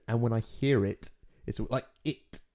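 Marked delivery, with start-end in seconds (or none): 2.1-2.4 "it" with a crisp, released T; fully pronounced T. Shown as a demonstration. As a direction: finish "it" on a deliberate hard T. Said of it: "When that's pronounced".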